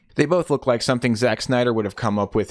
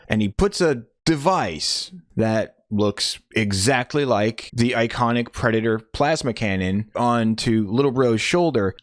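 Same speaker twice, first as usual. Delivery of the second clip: ordinary delivery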